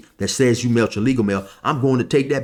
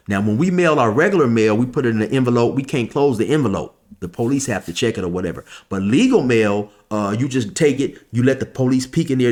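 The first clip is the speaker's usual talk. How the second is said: ordinary talk, nothing marked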